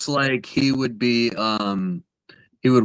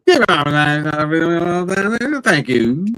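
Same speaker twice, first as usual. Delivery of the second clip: mumbled fairground PA announcement; garbled ride operator; 6 semitones higher than usual. Acted. Carnival operator giving riders safety rules, garbled. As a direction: run the words together like a garbled operator. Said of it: "You can't understand a fucking word he says".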